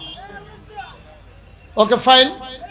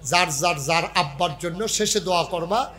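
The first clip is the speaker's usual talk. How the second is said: normal talking voice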